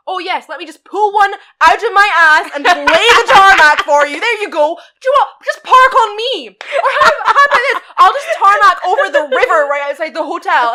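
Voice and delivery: high, loud mocking outburst; sarcastic rant. Sarcastic imagined reply to guest.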